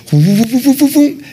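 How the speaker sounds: rapid whoops climbing high in pitch; imitated storm whooshing. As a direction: hoot out the rushing wind and waves. Imitating wind and waves.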